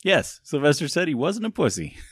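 laughing through the words; giggling disbelief; amused, laughing confirmation. Retold or imitated. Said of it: "was like".

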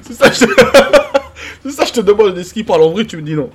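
talking through giggles; can barely get the words out laughing. Real, not acted. Cracking up retelling the exchange.

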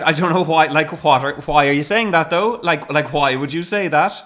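put-on high, loud lilting Cork accent; mock indignant Cork woman, sing-song. Retelling an edit, slipping into a Cork accent. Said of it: "I went very Cork at one bit".

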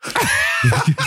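high forced nervous laugh; awkward laugh. Retold or imitated. Stared at by stranger, high awkward laugh. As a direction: squeak out a strained polite laugh.

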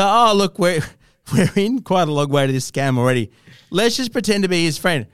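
high, sing-song fake-friend voice; scammer faking friendliness. Host mocks scammer pretending to be guest's friend, sing-song fake charm.

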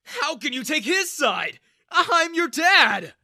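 high, furious shouting; outraged. A narrator's reading. Father demanding his daughter's loyalty, outraged. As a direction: build to a long, loud yell.

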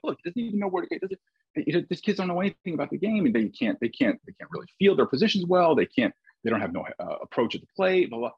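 put-on high-pitched, cocky complaining about the kids; griping to my mentor about useless players, with youthful arrogance. Young coach complaining to Jack, arrogant and high-pitched. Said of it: "in my youthful arrogance".

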